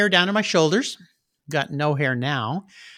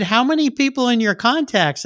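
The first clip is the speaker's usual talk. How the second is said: incredulous question; 8 semitones higher than usual. Retold or imitated. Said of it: "She said".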